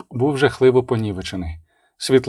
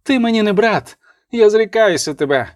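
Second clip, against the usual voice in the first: higher-pitched, impassioned renunciation; defiant cry; 8 semitones higher than usual. A narrator's reading. Abbess disowns her brother the prince, defiant cry. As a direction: cry it out high, short of a full yell. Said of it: "прокричала, спльовуючи кров, Абатиса".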